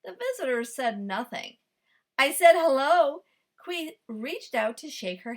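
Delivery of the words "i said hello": louder, firm hello; insistent. Acted. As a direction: demand an answer, louder. Kui insists loudly.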